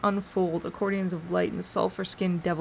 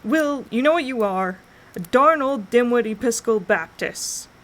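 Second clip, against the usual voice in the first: loud teasing call, higher; called back; 5 semitones higher, 7 dB louder than usual. A narrator's reading. Jim calls back to Will from three houses down, loud and teasing. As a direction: call the insult back down the street. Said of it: "he called back".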